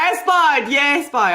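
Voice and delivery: loud, high whoop of recognition; delighted outburst. Real, not acted. Host hears caller is from Newfoundland, delighted outburst. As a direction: cheer a fellow East Coaster, then ease off laughing.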